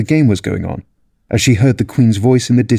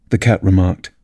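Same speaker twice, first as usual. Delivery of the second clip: ordinary delivery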